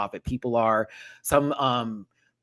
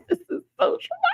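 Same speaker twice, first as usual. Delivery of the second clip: high squeal. Real, not acted. Laughing repetition of phrase.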